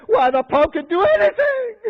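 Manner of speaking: high-pitched, heated proclamation; acted-out indignant tirade; haranguing. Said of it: "This man in his harangue said".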